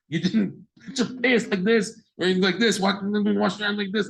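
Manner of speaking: nitpicking parent. Retold or imitated. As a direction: nag the child over every little detail. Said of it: "the parent is like".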